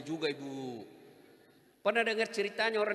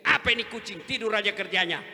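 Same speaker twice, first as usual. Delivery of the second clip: wife scolds cat; 7 dB louder than usual. Retold or imitated. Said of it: "Lewat istrinya".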